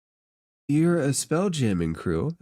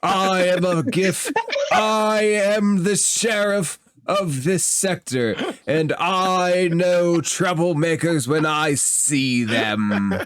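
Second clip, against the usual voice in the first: slow, loud, high-pitched offended lawman; indignant sheriff; 7 semitones higher, 4 dB louder, slower than usual. Acted. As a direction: boom it out, deeply insulted. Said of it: "and he goes".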